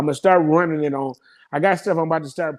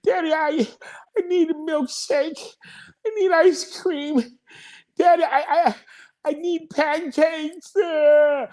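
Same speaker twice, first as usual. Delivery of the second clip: put-on much higher, whiny little kid listing what he needs; imitating his little son; 11 semitones higher than usual. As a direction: play the four-year-old begging Daddy for treats, high-pitched. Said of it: "He be like".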